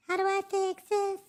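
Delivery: very high-pitched cartoonish squeak; silly squeaky voice. Streamer repeats his own question to himself, silly squeaky voice.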